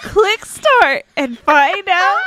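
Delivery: slow, loud, high ad pitch; infomercial voice. Retold or imitated. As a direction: drag out the ad call to action. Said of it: "should be an infomercial voice".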